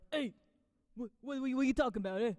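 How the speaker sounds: lightly voiced smoker; a hint of a raspy chain-smoker; smoker character. Shown as a demonstration. Showing her improv smoking character, lightly voiced. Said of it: "impression of someone who smokes".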